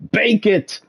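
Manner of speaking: playful shouted kitchen order; mock chef yell. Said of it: "You just hear him screaming in the background".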